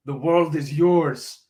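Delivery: slow, drawn-out mock Cuban gangster accent; bad Scarface accent. Podcaster quoting Scarface to his listeners, slow hammed-up Cuban accent. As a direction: ham up a Cuban Scarface accent, stretching every word. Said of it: "That was a really bad Scarface accent".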